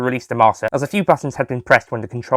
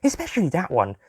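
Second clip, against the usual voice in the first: put-on girl voice; 5 semitones higher, 5 dB quieter than usual. A narrator's reading. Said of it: "added Susie".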